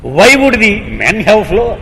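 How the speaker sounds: loud, high, incredulous question; storyteller acting an outraged visitor; indignant retort. A narrator's reading. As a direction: challenge them loudly in disbelief. Sadhu retorts in disbelief.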